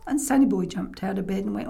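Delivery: ordinary delivery, nothing marked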